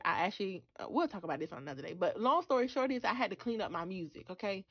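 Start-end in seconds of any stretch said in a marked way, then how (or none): none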